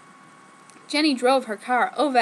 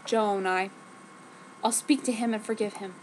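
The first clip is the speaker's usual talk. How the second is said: ordinary delivery, nothing marked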